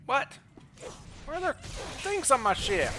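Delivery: high, loud startled yelp; sudden loud high alarm. Real, not acted. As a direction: jump at the surprise, pitch way up. Sudden surprise in game.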